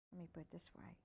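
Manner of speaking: ordinary delivery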